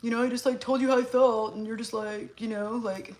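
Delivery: lightly voiced complaining ex; imitating upset exes. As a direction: mimic an emotional partner's reproach, lightly put on. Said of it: "they've been like".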